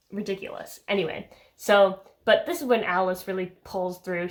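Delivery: ordinary talk, nothing marked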